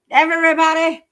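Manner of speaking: loud high emphatic shout. Real, not acted. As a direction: yell the word out. Emphatic repeat.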